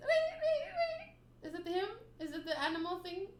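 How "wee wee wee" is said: high squeaky wee, much higher; creature squeak. Retold or imitated. Reader voicing the creature's squeak through laughter. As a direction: squeak it like a tiny sea creature. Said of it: "It says".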